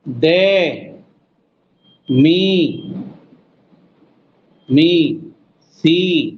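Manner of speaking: ए के दे मी सी अ न as separate beats; syllable count. Shown as a demonstration. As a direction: tap every syllable. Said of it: "बहुत सारे सिलेबस हो गए". (एक-एक शब्दांश)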